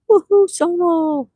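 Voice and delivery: high, loud, drawn-out whoop; celebratory cheer. Real, not acted. Streamer cheers Soma's thousand views, high celebratory whoop.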